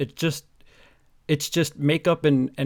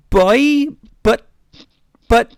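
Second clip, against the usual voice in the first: high loud hammed-up yell; mock shout; 9 semitones higher, 11 dB louder, much slower than usual. Caught boyfriend blurting out a loud mock shout.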